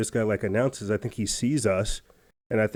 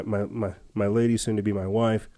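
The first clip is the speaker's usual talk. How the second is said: usual speaking voice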